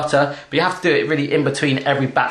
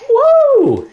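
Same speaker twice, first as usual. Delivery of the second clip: high, loud whoop; excited woo; 6 dB louder than usual. Real, not acted. Dad whooping as the butter goes in. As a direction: whoop with delight.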